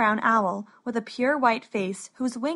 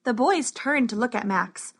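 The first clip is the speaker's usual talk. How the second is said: plain reading voice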